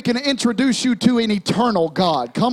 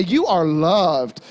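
like you mean it; 7 semitones lower, slower than usual. Shown as a demonstration. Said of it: "tell them like you mean it".

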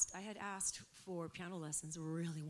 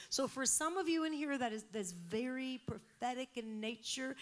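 ordinary talk, nothing marked

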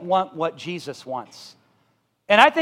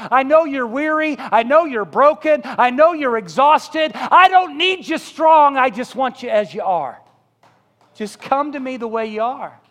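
Jesus' invitation; 6 semitones higher, 7 dB louder than usual. Acted. Pastor voices Jesus inviting the broken, fervent and tender. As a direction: call them in with pleading love.

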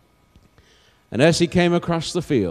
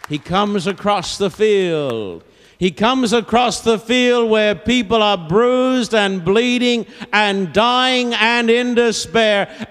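slow, rising, high preacher's cry; impassioned preaching; 8 semitones higher, 4 dB louder than usual. Real, not acted. Preacher telling of the Father seeking people, impassioned preaching. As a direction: build to a fervent peak. Preacher builds to full force.

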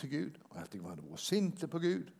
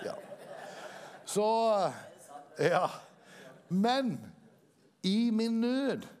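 preaching; 4 semitones higher than usual. Acted. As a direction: build the testimony with fervour. Preaching testimony rising in force.

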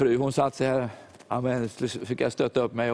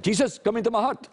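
prayed much higher, like a soldier's order; military-sounding prayer; 8 semitones higher than usual. Retold or imitated. English officer prays for salvation, mock military voice. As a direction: pray it like reporting to an officer. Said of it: "Han lät så nästan militärisk".

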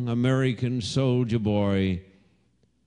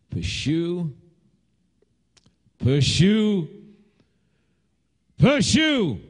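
building from high to a very high, louder shout; urgent repeated command, climbing steeply; rallying cry; 8 semitones higher, 3 dB louder, slower than usual. Retold or imitated. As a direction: cry it out harder each time.

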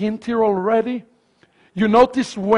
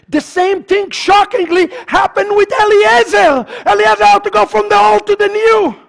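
high, driving preacher's cry; full-force preaching; 9 semitones higher, 4 dB louder than usual. Real, not acted. Preacher telling congregation of Eliezer's change, full-force preaching. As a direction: ring it out to the congregation.

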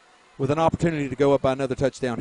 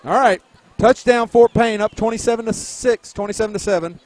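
excited; 8 semitones higher, 7 dB louder than usual. Real, not acted. Excited score recap.

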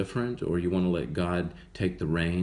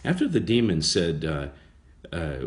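ordinary delivery

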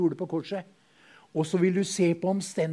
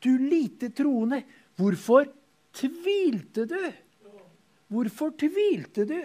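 higher, slow, gentle reproach; Jesus rebuking; 8 semitones higher, 4 dB louder, much slower than usual. Retold or imitated. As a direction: slowly question his doubt. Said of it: "og sa til ham".

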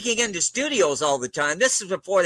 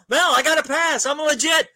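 higher, eager pitch to prove himself; excited young actor calling out to Mel; eager excitement; 8 semitones higher than usual. Retold or imitated. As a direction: blurt it out like a kid with good news. Runs over to Mel excitedly.

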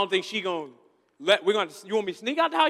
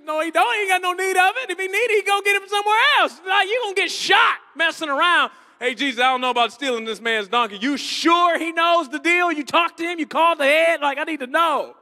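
loud, higher, fast protest; hammed-up disciple objecting; mock indignant rant; 6 semitones higher, 9 dB louder than usual. Disciple protesting loudly to Jesus about the donkey.

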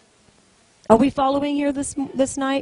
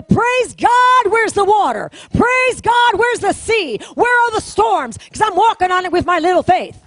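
high, loud triumphant cry; defiant shout; 5 semitones higher, 4 dB louder than usual. Acted. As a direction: shout it back at the enemy in triumph. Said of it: "You say".

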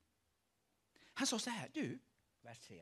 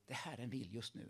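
ordinary delivery

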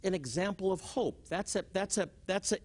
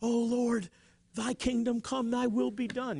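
higher, level chanting prayer; mock pleading prayer, pitched up; imitated fervent prayer; 4 semitones higher than usual. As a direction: recite it like an anxious churchgoer praying. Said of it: "the ones that are non-charismatic".

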